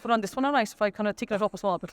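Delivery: fast, thick County Kerry accent. Shown as a demonstration. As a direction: rattle it off in your broadest Kerry accent. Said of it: "best Kerry accent".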